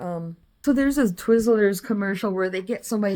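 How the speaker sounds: ordinary talk, nothing marked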